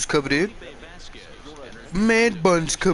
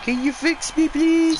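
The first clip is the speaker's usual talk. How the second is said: much higher, strained squeak after a nutshot; high squeaky injured voice; 12 semitones higher than usual. Pretend. To the doctor, telling the batting cage story in a squeak. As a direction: squeak it out like your nuts just got hit. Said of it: "That's why I'm talking like this".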